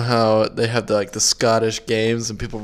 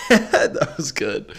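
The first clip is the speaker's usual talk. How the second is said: laughing; 4 semitones higher, much slower than usual. Real, not acted. Laughing at partner's attempt.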